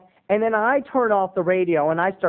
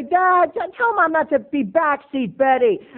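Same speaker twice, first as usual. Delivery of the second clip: high, louder whine; whiny son; 10 semitones higher, 5 dB louder than usual. Retold or imitated. Son appealing to dad, high whiny voice. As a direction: complain to dad like a kid. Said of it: "And I would say".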